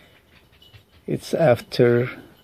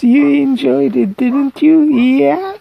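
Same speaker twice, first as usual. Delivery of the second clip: put-on high baby talk; 10 semitones higher, 4 dB louder than usual.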